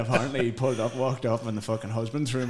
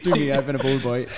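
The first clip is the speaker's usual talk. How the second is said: cheeky quote; 3 semitones higher, 7 dB louder than usual. Retold or imitated. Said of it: "Peter took his top off and was like".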